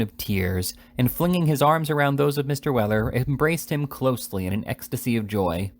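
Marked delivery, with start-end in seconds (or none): none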